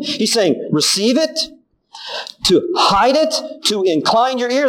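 ordinary delivery

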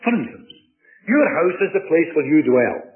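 ordinary delivery

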